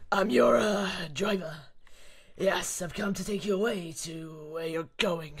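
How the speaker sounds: lightly voiced big soldier, faking friendly; Krauser voice. Krauser posing as a driver to Ashley, gruff and menacing. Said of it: "let me get the Krauser voice on".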